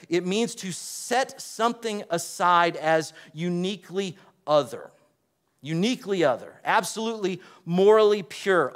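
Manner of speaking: normal talking voice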